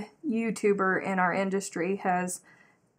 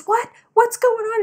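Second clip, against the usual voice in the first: high, louder urgent question; startled questioning; 14 semitones higher, 8 dB louder than usual. Retold or imitated. Cousin's husband interrupting the screaming women, startled questioning. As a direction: butt in, alarmed by all the screaming.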